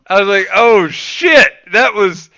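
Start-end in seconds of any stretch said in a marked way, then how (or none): 0.5-1.5 loud, high horrified gasp of realization; shocked dismay. Retold or imitated. Realizing the teammate heard every insult, horrified. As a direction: caught out and mortified.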